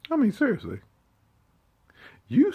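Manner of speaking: loud, high scoff on "I mean, seriously"; you can't believe them, let it fly; indignant outburst; exasperated. Real, not acted. Scolding rant, disbelief.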